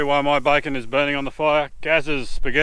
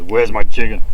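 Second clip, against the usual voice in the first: accusing; 4 semitones lower, 8 dB louder than usual. Retold or imitated. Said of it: "It's like an accusation".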